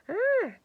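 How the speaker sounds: sudden high loud cry of fright; startled cry. Acted. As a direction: yelp like you've been caught stealing. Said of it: "cried the night man".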